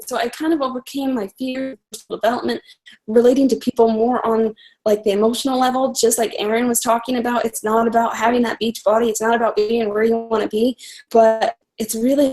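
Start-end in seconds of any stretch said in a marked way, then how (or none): none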